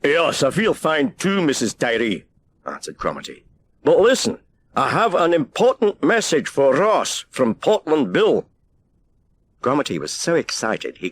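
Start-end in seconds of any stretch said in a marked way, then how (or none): 0.0-2.2 lighthouse keeper. A narrator's reading. Keeper answers on the radio. 3.8-8.5 eager keeper. A narrator's reading. Keeper urges over the line.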